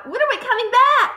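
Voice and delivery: put-on high, urgent child's question; upset girl, pitched high; anxious cry. A narrator's reading. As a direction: demand an answer in distress. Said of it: "Matilda cried".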